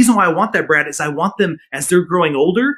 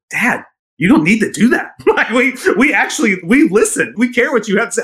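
imitating his young kids, laughing and higher; kids' voice; 8 semitones higher than usual. Young kids reassuring their dad, in a raised laughing childlike voice.

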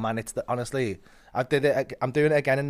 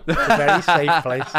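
higher and much louder, laughing through it; mock-defend the venue while cracking up; laughing ironic reassurance; 4 semitones higher, 10 dB louder than usual. Real, not acted.

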